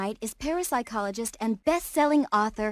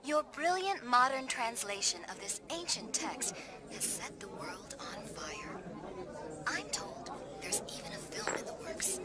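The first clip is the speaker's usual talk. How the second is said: hushed gush; 2 semitones lower, 11 dB quieter than usual. Acted. Host gushing, voice drops to whisper.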